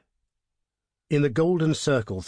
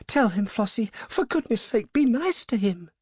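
high-pitched, anxious urging; frightened young woman pleading; pleading cry; 10 semitones higher than usual. A narrator's reading. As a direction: beg your friend in a high voice. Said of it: "cried Hilda".

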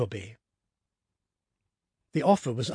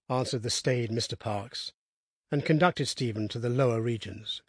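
usual speaking voice

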